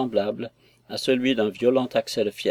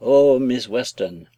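louder, slow, longing exclamation; ardent cry; 6 dB louder, slower than usual. A narrator's reading. Jones cries out to Sophie, louder, slow and longing. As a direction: cry out her name with yearning. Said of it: "il s'écria".

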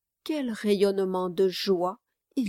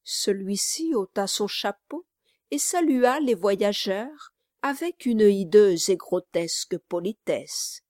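ordinary talk

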